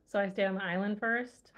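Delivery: ordinary talk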